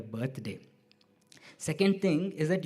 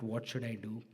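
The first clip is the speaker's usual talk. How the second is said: retold inner thought; 4 semitones lower, 10 dB quieter than usual. Retold private worried thought.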